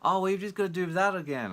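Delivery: mock eager pushy voice. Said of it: "people will jump on it and like".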